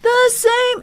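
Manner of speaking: high sung note hit hard and direct; straight-on strained tone. Shown as a demonstration. Coach showing the straining way to hit the note. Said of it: "trying to hit it, like, straight on like this".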